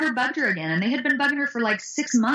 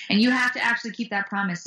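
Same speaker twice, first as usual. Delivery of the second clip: ordinary talk, nothing marked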